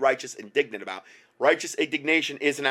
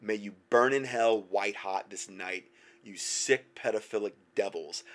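laying a curse; 5 semitones lower, 6 dB quieter than usual. Real, not acted. Preacher curses pedophiles.

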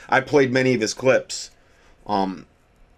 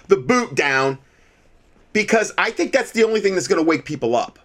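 heated, raised-pitch defiant rant; paranoid late night rant; 6 semitones higher than usual. Real, not acted. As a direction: shout your defiance at the devil, pitched high. Host raging at Biden over mandates.

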